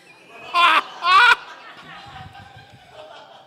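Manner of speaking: big high burst of laughter; loud cackle. Real, not acted. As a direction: laugh out loud at your own joke. Laughs at her own punchline.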